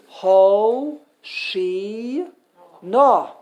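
"Ho, she, na" broken into three separate syllables with pauses; split into syllables. Shown as a demonstration. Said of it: "How many syllables are there in the word Hoshia?".

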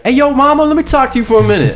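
high, loud catcall voice; hollering neighbor. Retold or imitated. Neighbor catcalling women at the gas station, high loud holler. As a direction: holler it out the car window at passing women.

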